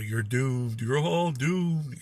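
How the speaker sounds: lightly voiced doomsayer, slow; slow ominous raspy drawl. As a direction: drag out the warning like a creepy old local. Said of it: "The ... guy".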